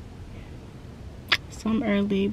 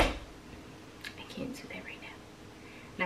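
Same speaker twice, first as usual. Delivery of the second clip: whispering; 16 dB quieter, much slower than usual. Real, not acted. Dreading a bad hair day.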